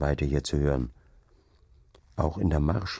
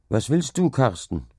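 higher-pitched acted call; 6 semitones higher than usual. A narrator's reading. Farmhand calls to the approaching servant boy, mild call. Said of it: "rief ihm der Knecht entgegen".

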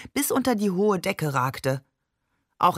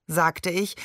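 usual speaking voice

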